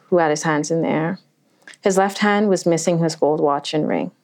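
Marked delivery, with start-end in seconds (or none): none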